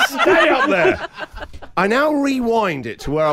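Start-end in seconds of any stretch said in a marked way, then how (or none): 0.1-1.1 much higher, louder and slow; exasperated yell. Retold or imitated. Dad calls up to the laughing daughter, much higher and louder. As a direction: yell it up the stairs in despair.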